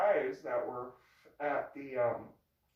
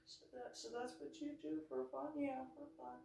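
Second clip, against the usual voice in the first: hushed skeptical question; 10 semitones higher, 12 dB quieter than usual. Retold or imitated. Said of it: "He's just like".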